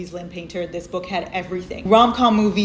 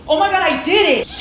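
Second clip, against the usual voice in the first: much higher, louder celebration; squealing excited; 11 semitones higher, 6 dB louder than usual. Real, not acted. Balancing books on camera, squealing excited. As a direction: celebrate catching the book stack. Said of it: "repeated higher".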